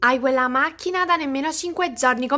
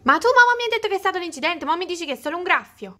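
much louder and higher, then fast and indignant; angry outburst; 4 semitones higher, 4 dB louder, much faster than usual. Acted. A sister accusing Maria Rita of lying about the crash, in an angry outburst. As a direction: blow up at her sister, then rattle off the accusation. Sister confronts her over the car.

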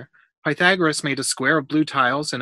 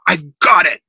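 loud, higher burst of triumph; excited discovery; 5 semitones higher, 10 dB louder than usual. A narrator's reading. Boy solving a puzzle by himself, excited discovery. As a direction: exclaim it like a sudden breakthrough. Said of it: "he said to himself".

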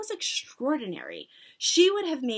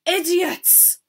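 high, loud insult; shouted; 8 semitones higher, 8 dB louder than usual. A narrator's reading. Grand High Witch rebuking her audience, shouted. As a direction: shout it in exasperation. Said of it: "shouted the Grand High Witch".

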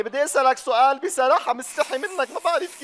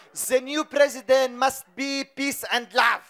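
put-on Arabic-accented English; much slower than usual. Slow broken English.

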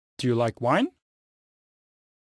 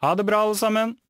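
cheerful goodbye; 8 semitones higher, much faster than usual. Real, not acted. Farewell to everyone.

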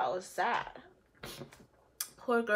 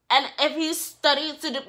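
ordinary talk

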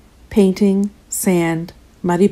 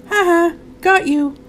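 high, teasing laugh; mock the kid you just hit; 11 semitones higher than usual. A narrator's reading. Children taunting Dev after a hit, laughing. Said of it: "laughed the other children".

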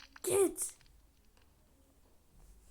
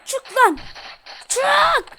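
loud higher yell; loud frustrated yell at the enemy; shouting; 6 semitones higher, 10 dB louder, slower than usual. Real, not acted. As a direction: bark it out at the enemy. Urging in fight.

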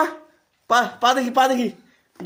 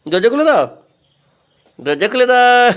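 calling out; 4 semitones higher, 5 dB louder than usual. Real, not acted. Calling to open door.

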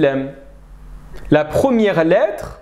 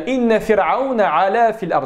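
recited verse; 3 semitones higher than usual. Shown as a demonstration. Teacher pointing students to the verb in the verse. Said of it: "regardez bien".

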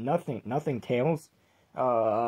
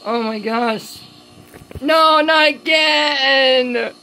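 1 panicked screaming; 13 semitones higher than usual. Acted. Tails panics at scary episode.

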